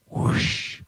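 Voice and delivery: airy imitated whoosh; whoosh noise. Imitating money transferring to the bank, whispered whoosh. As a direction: make a voiceless rushing whoosh. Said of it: "The whoosh noise".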